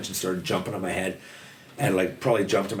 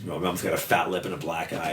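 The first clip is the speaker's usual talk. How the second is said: ordinary talk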